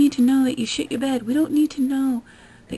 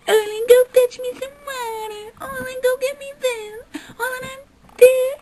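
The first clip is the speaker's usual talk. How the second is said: much higher, louder, half-laughing put-on whine; whiny demanding impression; 13 semitones higher, 5 dB louder than usual. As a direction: boss Orlin around like a spoiled brat. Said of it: "I don't get lazy and go".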